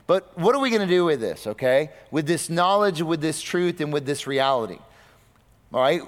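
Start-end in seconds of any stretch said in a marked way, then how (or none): none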